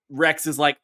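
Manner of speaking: usual speaking voice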